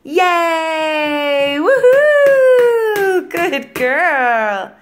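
loud high woohoo; cheering. Real, not acted. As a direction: cheer her on, big and high. Cheering toddler's success.

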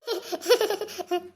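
short burst of laughter; acted laugh. Mother-in-law caught up in her serial, laughing.

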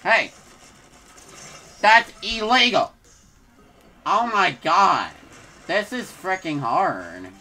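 loud outraged yell, slowing down; frustrated shout. Real, not acted. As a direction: rage at the game in real frustration. Frustrated by enemy attack.

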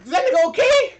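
high, loud shocked question. Real, not acted. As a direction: voice jumps up in disbelief. Shocked at tackle.

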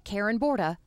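ordinary talk, nothing marked

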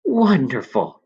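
put-on young man bursting with delight; cried out. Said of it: "cried Alan".

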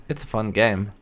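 normal talking voice

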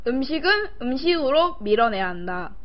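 usual speaking voice

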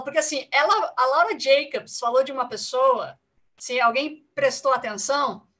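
ordinary talk, nothing marked

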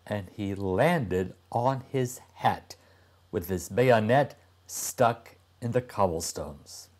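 usual speaking voice

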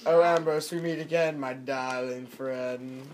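unhurried greeting with sinking, stretched ending; smooth drawn-out greeting. Shown as a demonstration. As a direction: ease down low and slow on "my darling friend". Demonstrating slower, lower villain greeting.

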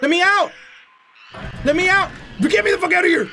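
high-pitched desperate demand; panicked pleading. Real, not acted. Player trapped while fleeing, panicked pleading. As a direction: plead urgently to escape. Trying to escape monsters.

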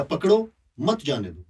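पकड़ो on its own, then मत जाने दो as one phrase; pause after पकड़ो. Shown as a demonstration. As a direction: put a comma-like break right after पकड़ो. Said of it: "के बाद अगर कॉमा लगा देंगे".

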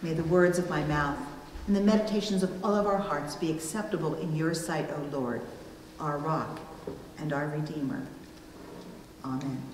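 soft, quiet prayer voice, a little hushed. Real, not acted. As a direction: pray it softly, bringing the congregation in. Opening prayer before the sermon.

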